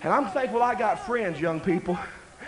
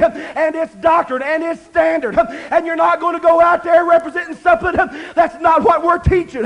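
put-on rising, louder preaching as the old pastor; preached stern warning; 6 semitones higher, 5 dB louder than usual. Preacher quoting his pastor forbidding the trip, loud and stern. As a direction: lay down the law from the pulpit. Pastor quoted laying down the rule.

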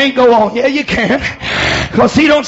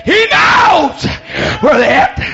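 emphatic preaching shout; 2 semitones higher, slower than usual. Real, not acted. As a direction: proclaim it triumphantly to the congregation. Repeats line shouted to congregation.

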